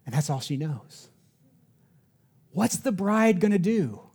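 normal talking voice

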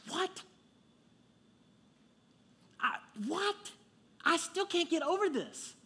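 high, stunned "what?"; can't believe what he just read; marvelling at the line; amazed disbelief. Real, not acted. Amazed by the verse.

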